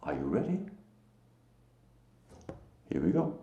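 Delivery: ordinary talk, nothing marked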